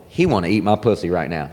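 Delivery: unspoken thought. Acted. Women knowing what men want, unsaid thought. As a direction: keep it low, like a private thought. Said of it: "rarely will they say it".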